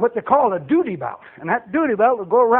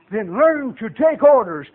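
normal talking voice

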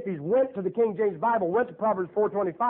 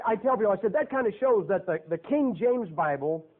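normal talking voice

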